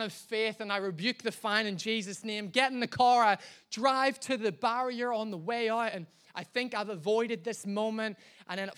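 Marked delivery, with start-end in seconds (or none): none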